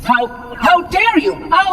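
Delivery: so offended the words catch; sputtering outrage. Acted.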